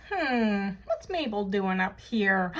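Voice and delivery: ordinary delivery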